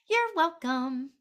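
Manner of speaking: a lilting, slow sing-song thank you; sing-songy. Real, not acted. Medium thanks her viewer in a slow sing-song. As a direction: sing it out lightly, stretching each word. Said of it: "I'm so sing-songy".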